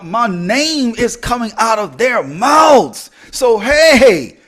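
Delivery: loud, stretched-out proud exclamation; carried away, loud. Real, not acted. Speaker celebrating that important people now say their name, carried away. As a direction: build to a drawn-out, triumphant holler. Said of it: "Dial it back".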